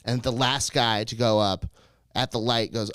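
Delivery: ordinary talk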